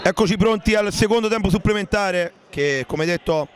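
ordinary talk, nothing marked

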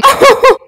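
short high-pitched laugh; delighted laugh. Real, not acted. Player flattered by friend's praise, delighted laugh. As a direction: burst out in a loud, high laugh.

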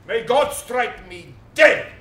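loud, slow vow to heaven; solemn oath. Acted. Prince swearing to the actress he was there, loud and slow. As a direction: swear it loudly and slowly.

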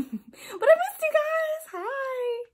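high, drawn-out affectionate squeal; excited greeting. Real, not acted. Vlogger greeting missed viewers, high excited squeal. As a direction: squeal it out to your fans.

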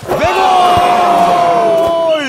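loud goal cry; screaming football commentator. Acted. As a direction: shout the goal out.

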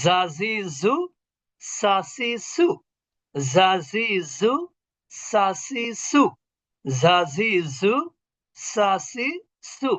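জা জি জু buzzed as z, সা সি সু hissed as s; buzzing, hissing sibilants. Shown as a demonstration. Teacher drilling sibilants for pupils, buzz then hiss. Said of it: "এই ধরনের উচ্চারণ হবে".

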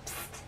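soft hissed psst; a secret hiss for attention; whispered psst. Acted. Secretly calling Romeo.

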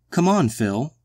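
crying out. A narrator's reading. Irene urging Phil to come along, crying out. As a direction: call out to coax him along. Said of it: "cried Irene".

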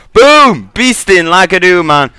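loud, high burst of gamer hype; real pumped-up yell; excited hype. Real, not acted. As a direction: shout it like you just got the kill. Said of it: "Sorry if I sound a bit excited in my voice".

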